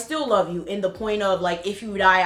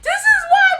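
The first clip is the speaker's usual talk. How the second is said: much higher, crying mock whine; 4 dB louder than usual. Mocking how her ex whined at her, much higher and crying. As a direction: imitate him whining like a kid. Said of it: "he like".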